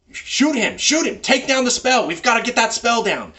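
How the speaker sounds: urgent, high-pitched battle shouts; bad guys yelling. Bad guys coordinating on their turn, yelling. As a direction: yell orders to comrades in combat. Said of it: "coordinate and yell at each other".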